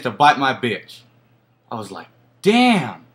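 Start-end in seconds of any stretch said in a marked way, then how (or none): 2.4-3.0 mock dismay. Retold exclamation at the bite.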